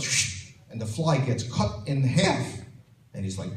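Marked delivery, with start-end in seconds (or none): 0.0-0.5 unvoiced whoosh of a blade; a quick hissed sword slice; samurai's sword cutting a fly, hissed swish. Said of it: "and he goes".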